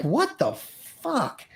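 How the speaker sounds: high, drawn-out retold bewilderment; stunned disbelief. Performer recalling a sudden hit on stage, stunned disbelief. Retelling his shock at being hit.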